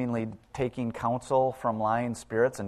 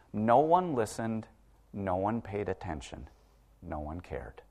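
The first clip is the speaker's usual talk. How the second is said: each phrase softer until almost gone; fading to a hush; 3 semitones lower, 6 dB quieter than usual. Acted. Preacher reading the bleak verse to his congregation, fading to a hush. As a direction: let it die away into silence.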